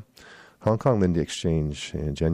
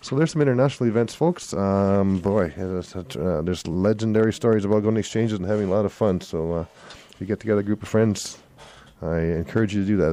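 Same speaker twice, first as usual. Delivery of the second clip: usual speaking voice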